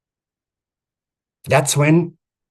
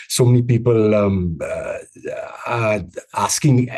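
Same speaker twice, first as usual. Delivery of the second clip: filler words; 4 semitones lower than usual. Shown as a demonstration. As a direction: stumble through it with fillers everywhere. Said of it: "If I, uh, came on, said".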